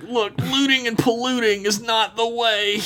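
high-pitched, hammed-up proclamation; comic high moralizing; mock distraught plea. Acted preachy rant of Joe.

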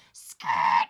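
low, loud screech of brakes; loud vocal tyre screech; screeching tyres imitation. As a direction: imitate a car skidding to a halt. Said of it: "You heard a car go".

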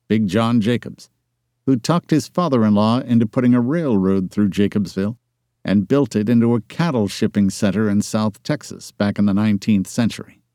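plain reading voice

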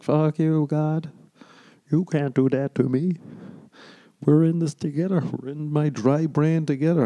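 high-pitched pleading with God; hammed-up high protest; mock protest. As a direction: argue back in a high, put-on voice. Acted reply arguing with God.

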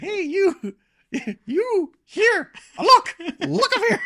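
very high falsetto calls, in short bursts; Steve Martin calling out. Retold or imitated. Acting out a movie scene, falsetto calls for attention. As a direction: squeal out for someone's attention from above. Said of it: "trying to get their attention".

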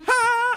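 very high-pitched "Ha!"; cartoon entrance cry. Host acts tuxedoed game avatar popping out, cartoon cry. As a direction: hop out with a squealed cry. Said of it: "he hops out like".

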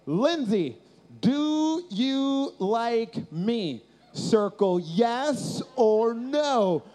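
very slow, drawn-out words; laboriously spelled-out love note; writing aloud. Acted. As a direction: say it as slowly as you would write it. Voicing the note as he writes.

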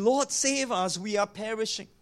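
high, urgent plea; mildly acted cry for rescue; crying out for help. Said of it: "they cried out".

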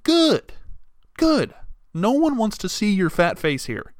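high, loud, sarcastic good riddance; snide mock retort. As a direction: fling it back at the customer with a sneer. Mocking rude customers who threaten.

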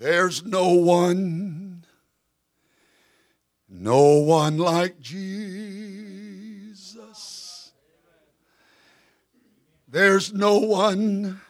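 slow, raspy sung close; hoarse preacher, drawn out slowly. Real, not acted. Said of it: "with my raspy voice to say it like this".

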